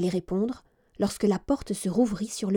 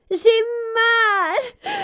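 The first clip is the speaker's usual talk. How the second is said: tearful cry of pain; 13 semitones higher, 5 dB louder than usual. A narrator's reading. Princess in pain at midnight.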